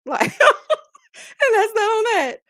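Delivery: high, loud and slow, laughing through it. Real, not acted. Host closing the story to her viewers, laughing. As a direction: drag it out while cracking up. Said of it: "repeats it laughing".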